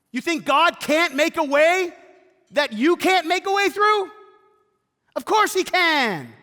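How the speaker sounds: loud, high, slow-building preacher climax; fervent sermon climax to the congregation; preaching at full force. Acted.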